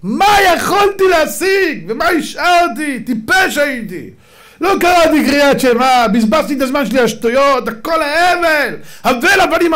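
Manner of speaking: high, loud, slow regretful wailing; dead man wailing. Acted. The dead man wailing over himself, loud and slow. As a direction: cry out in despair over a wasted life. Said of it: "האדם הזה בוכה על עצמו".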